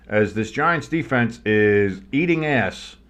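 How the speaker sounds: ordinary talk, nothing marked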